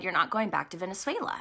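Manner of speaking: low, soft and quick, God speaking; a hushed divine voice, unhurried authority; quiet voice of the Lord. Retold or imitated. The Lord telling her quietly she won't return. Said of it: "the Lord said".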